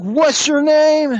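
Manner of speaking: high, slow, suspicious demand. Retold or imitated. Suspicious woman questioning a stranger, high and slow. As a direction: draw it out high and wary. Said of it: "She's real fucking suspicious".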